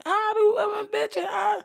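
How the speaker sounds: hammed-up shrill-pitched yell; imitating women screaming in a street fight; mock screaming woman. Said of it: "there be a lot of screaming".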